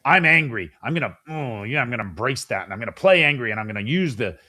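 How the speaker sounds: mock angry player. Volleyball player telling himself to play angry, lightly hammed-up. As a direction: ham up a hothead psyching himself up. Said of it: "You think".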